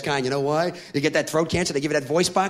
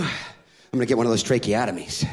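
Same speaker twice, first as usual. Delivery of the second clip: ordinary talk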